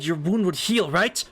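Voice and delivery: usual speaking voice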